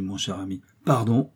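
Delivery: usual speaking voice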